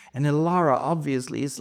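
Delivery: ordinary talk, nothing marked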